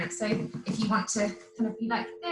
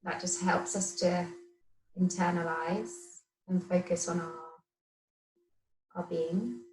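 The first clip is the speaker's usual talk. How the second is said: guided meditation. Acted. Yoga teacher settling the class to watch the breath, guided meditation. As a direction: talk them gently into stillness, phrase by phrase.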